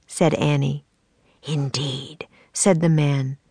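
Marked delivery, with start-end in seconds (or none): 1.4-2.3 soft secretive whisper. Acted. The man confirming to Annie in a whisper. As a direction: breathe the word as a secret.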